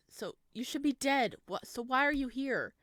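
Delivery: loud, high panicked cry; panicked shout. Acted. Samuel facing his dead mother, panicked shout. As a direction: yell it out in terror.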